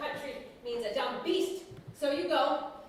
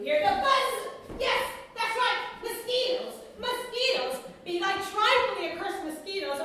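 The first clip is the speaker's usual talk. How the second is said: high, loud, slow cry of exasperation; exasperated outburst; 9 semitones higher, 6 dB louder, slower than usual. Acted. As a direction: rail at the buzzing mosquitoes in despair. Husband's exasperated mosquito rant.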